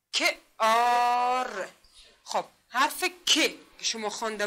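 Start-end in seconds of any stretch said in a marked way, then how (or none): 0.1-1.7 کار very slowly as که, then a loud, long آر; sounding out. Shown as a demonstration. Teacher repeating for pupils, کار loudly stretched out. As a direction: hold آر loud and long after short که.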